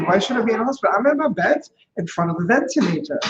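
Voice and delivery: ordinary talk, nothing marked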